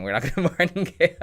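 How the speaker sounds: breaks into a laugh mid-line; giggling at his own joke. Real, not acted. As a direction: laugh through the denial. Joking about doubling down.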